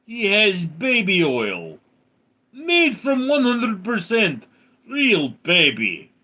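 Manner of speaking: high, drawn-out mock Russian voice; Russian accent. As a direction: ham up a slow Russian accent. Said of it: "in a Russian accent".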